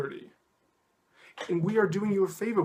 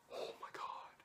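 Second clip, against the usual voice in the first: exasperated whisper; 18 dB quieter than usual. Real, not acted. Aside after listing the accusations.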